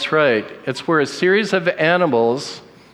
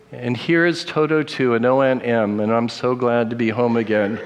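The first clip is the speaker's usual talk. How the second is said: ordinary talk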